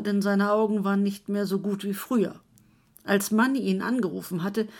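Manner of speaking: ordinary delivery, nothing marked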